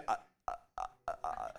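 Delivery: deep, breathy stunned stammering; lost for words before God, just stammer; speechless stammer. Retold or imitated. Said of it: "you're gonna say".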